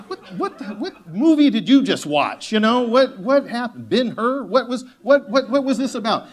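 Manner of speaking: high, stammering mock disbelief; incredulous. Real, not acted. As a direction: sputter in amused disbelief. Mock disbelief at CEO's words.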